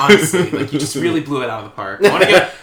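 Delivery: loud, hammed-up boss praising staff; mock shout-out. Podcast host mock-thanks the effects guy, loudly. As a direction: announce it like an office award, loud and proud.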